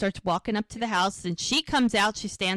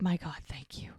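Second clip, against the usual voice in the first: whispered, low-key thanks, retold; imitated hushed relief; relieved whisper; 4 semitones lower, 9 dB quieter than usual. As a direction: whisper it as an acted sigh of relief. Relief in retold phone call.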